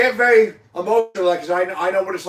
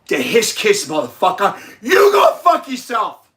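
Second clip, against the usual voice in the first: angry outburst; 2 semitones higher, 4 dB louder than usual. Real, not acted. Addressing the kid's stepfather, real angry outburst. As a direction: spit it at the stepfather. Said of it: "I got something to say".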